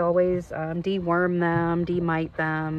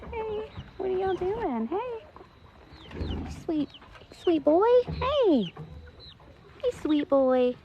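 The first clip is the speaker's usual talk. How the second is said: high cooing; 11 semitones higher, 3 dB quieter than usual. Real, not acted. Cooing to the pets.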